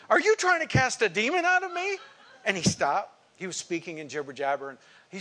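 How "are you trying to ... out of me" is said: high, loud, incredulous challenge; retold confrontation shouted high and loud; indignant shout. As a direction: shout it at him in disbelief. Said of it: "And I stood up and said".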